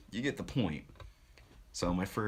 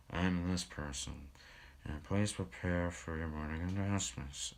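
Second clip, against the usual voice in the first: mock the dull morning announcements team; dry and boring. Student imitating the morning announcements team, dry and boring. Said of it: "just how dry and boring".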